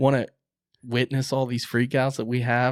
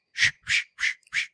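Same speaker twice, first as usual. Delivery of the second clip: short bursts of shushing noise; imitated crashing leaves. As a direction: mimic a deer kicking up leaves. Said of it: "sounds like a deer that crashed".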